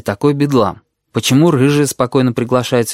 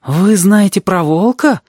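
slow, high-pitched astonished question; amazed; 8 semitones higher, 4 dB louder, slower than usual. A narrator's reading. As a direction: ask in drawn-out amazement. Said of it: "изумился Кузнечик".

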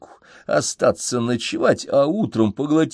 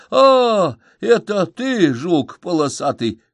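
yawning; 8 semitones higher, 5 dB louder, slower than usual. A narrator's reading. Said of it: "нагло зевает в трубку Мишка".

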